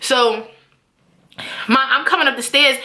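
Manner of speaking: ordinary delivery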